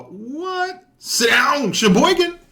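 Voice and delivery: sudden high yell at the screen; loud shout. Real, not acted. Excited viewer yelling at a game clip. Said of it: "Sorry for getting loud".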